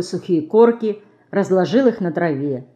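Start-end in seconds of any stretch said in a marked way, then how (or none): none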